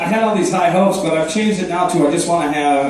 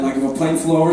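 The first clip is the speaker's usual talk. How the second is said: ordinary talk, nothing marked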